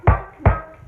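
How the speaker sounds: knock, knock shouted out; loud knocks. Shown as a demonstration. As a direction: punch each knock loud. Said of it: "trick-or-treaters go knock".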